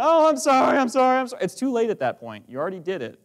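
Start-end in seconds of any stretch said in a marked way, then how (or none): 0.0-1.4 high, loud whiny apology; a child crying out sorry over and over; pleading child. Acted. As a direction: beg like a caught kid. Child pleading after misbehaving.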